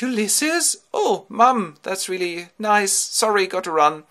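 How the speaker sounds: high, loud hurried greeting; mother's ghost. Acted. Ulysses' mother greets him briefly in Hades, high voice. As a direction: chirp hello then rush off.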